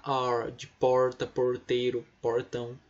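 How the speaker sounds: "porta", "porteiro", "portão" said slowly with a strong curled R; slow demo of the caipira R; American R. Shown as a demonstration. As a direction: stretch the R in "porta" like a country speaker. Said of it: "R de caipira que caipira fala porta".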